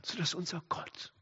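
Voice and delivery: hushed, breath-only whisper; soft whispered awe at God; awed whisper. Real, not acted. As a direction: whisper it in reverent wonder. Same conclusion repeated in hushed awe.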